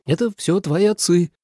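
high, accusing retort; snapping back. A narrator's reading. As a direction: shift the blame testily. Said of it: "огрызнулась Суэлань".

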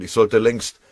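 loud, high-pitched urgency; pressing. A narrator's reading. Lieutenant overdue for take-off, pressing urgently. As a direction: insist that time is running out. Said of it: "drängte der Leutnant".

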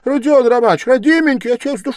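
high, loud, alarmed appeal; frightened pleading. A narrator's reading. Investigator begging the raging suspect, frightened and pleading. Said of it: "в совершенном испуге".

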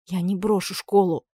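plain reading voice

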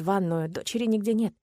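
ordinary delivery, nothing marked

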